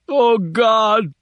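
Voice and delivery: high wail of pain; pained cry. Acted. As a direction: moan it in agony. In agony.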